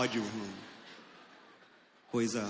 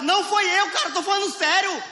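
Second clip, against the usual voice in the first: high defensive denial; 4 dB louder than usual. Retold or imitated. Acted denial of farting.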